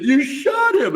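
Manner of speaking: put-on high, loud yell of a stunned child; shocked kid yelling. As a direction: blurt it out like a horrified kid. Kid yells after Santa shot.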